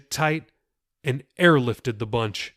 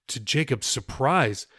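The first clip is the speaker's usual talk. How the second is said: usual speaking voice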